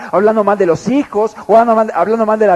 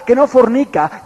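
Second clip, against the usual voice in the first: ordinary talk, nothing marked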